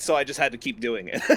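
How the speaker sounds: normal talking voice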